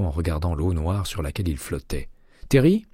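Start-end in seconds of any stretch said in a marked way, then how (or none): 2.5-2.8 voix de Jay plus aiguë, enjouée; Jay au téléphone. A narrator's reading. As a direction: interlocutrice jouée un ton plus haut, légèrement animée. Jay reprend la ligne. (Jay, inspectrice, répond à McCaleb au téléphone, voix plus haute)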